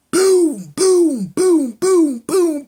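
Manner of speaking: loud, high-pitched booming shots; imitated gunfire. Brother comes up dumping, gunfire imitated loudly. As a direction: punch out each shot on a high note.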